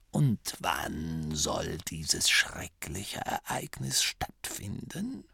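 put-on very soft, slow, ironic old voice; mock-concerned old abbot. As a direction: feign worry, barely audible. Abbot mocks bad news.

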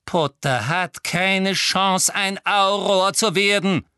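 high, loud, slow furious pronouncement; relentless anger. A narrator's reading. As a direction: hammer out each word in rage. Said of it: "einem unerbittlichen Zorn gewichen".

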